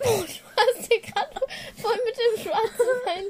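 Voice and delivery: high laughing words. Real, not acted. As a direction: talk through real laughter. Bursts out laughing at friend.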